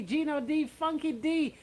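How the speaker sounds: normal talking voice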